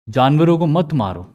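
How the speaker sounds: ordinary delivery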